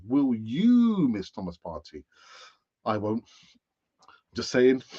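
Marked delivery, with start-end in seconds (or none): 0.1-2.0 slow question with YOU stretched out; stress on YOU. Real, not acted. Host repeating the poll question to viewers, stress on YOU. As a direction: swell and swoop on "you", then let the name drop.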